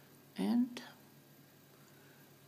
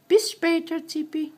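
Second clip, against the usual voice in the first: parrot voice; 7 semitones higher, 7 dB louder than usual. Retold or imitated. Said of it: "you didn't hear her say".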